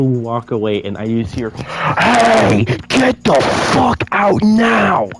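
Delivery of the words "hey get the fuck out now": high, loud angry yell at intruders; retold furious shout. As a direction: bellow it like an enraged store worker throwing you out. Angry worker yells at them.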